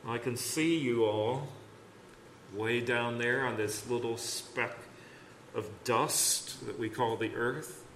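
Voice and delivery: quietly musing, lightly voiced God; God speaking down to creation. God to tiny humans in his hand, quietly musing. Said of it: "God just picks up the whole universe and says".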